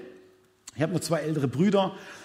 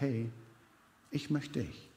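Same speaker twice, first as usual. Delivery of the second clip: tender God voice; 5 semitones lower, 9 dB quieter than usual. Pretend. God reassuring the doubting listener, soft and tender. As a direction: gently speak as God who wants you.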